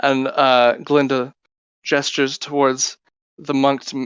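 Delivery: ordinary talk, nothing marked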